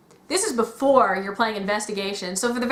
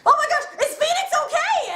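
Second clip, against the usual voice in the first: very high, laughing panic; hammed-up worry for a friend; mock freaking out. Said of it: "freaking out".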